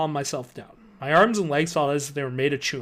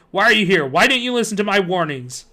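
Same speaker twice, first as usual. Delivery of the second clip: angry; 4 semitones higher, 6 dB louder than usual. Acted. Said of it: "the man said angrily".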